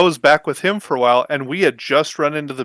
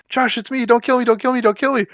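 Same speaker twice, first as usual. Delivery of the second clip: high-pitched mock begging, rattled off repeatedly; retold panicked yelling; 10 semitones higher than usual. Chris pleading with a teammate not to fire, panicked. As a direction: imitate a frantic plea. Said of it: "then Chris is yelling".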